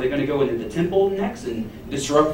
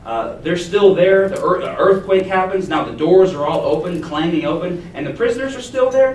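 excited; 5 semitones higher, 5 dB louder than usual. Real, not acted. Preacher excitedly retells the scene.

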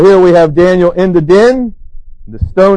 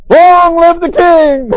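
ringing call; 10 semitones higher, 4 dB louder, slower than usual. Retold or imitated. Voice from the den, surprise.

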